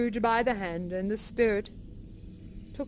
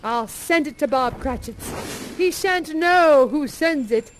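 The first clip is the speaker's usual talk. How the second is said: gleeful scheming; 5 semitones higher, 6 dB louder than usual. Acted. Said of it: "says Scrooge".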